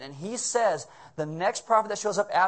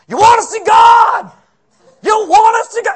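drill sergeant; 18 dB louder than usual. Retold or imitated. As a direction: bark at the recruits like a drill sergeant. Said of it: "like a freshman football coach or, or a drill sergeant".

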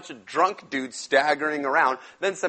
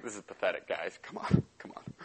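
soft, lower, fading to a whisper; muttered mockery; 8 semitones lower, 8 dB quieter, faster than usual. Elijah mutters at the failing prophets, soft and whispered. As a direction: shake your head and mutter it.

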